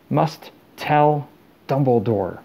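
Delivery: halting, laboured words with a pause between; terrible effort. A narrator's reading. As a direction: drag out each word as if it hurts. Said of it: "seemed to cost him a terrible effort".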